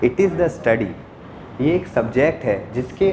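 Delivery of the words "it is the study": ordinary talk, nothing marked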